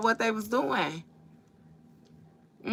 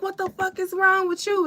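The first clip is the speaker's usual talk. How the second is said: much higher, outraged squeal; indignant yell; 13 semitones higher than usual. Retold or imitated. After she punches him, he yells up high at her. Retold movie fight, he yells.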